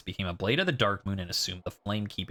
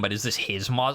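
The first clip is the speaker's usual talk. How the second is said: ordinary talk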